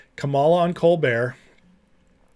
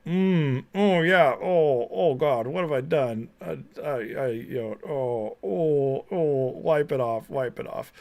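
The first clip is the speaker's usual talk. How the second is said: mock Kamala. Mocking Kamala tasting beer again.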